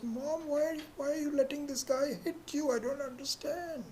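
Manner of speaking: put-on high, pleading little girl voice; a bewildered toddler begging her mom; small child. Toddler rushes onstage, pleading with her mom in a high child's voice. Three-year-old runs to mom on stage.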